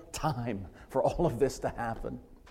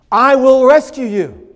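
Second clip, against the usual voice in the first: I will rescue you, much louder and higher, with the peak on "rescue"; ringing emphatic promise; 9 semitones higher, 13 dB louder than usual. Acted. Preacher booming God's promise against self-rescue, loud and high. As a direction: proclaim "rescue" like a trumpet call. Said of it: "He doesn't give them suggestions".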